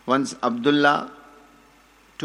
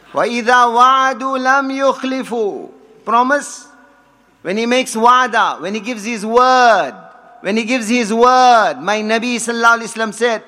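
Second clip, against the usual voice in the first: raised, loud preacher's voice; full-force sermon delivery; fervent preaching; 8 semitones higher, 6 dB louder, faster than usual. Acted. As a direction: preach it out to the hall with fire.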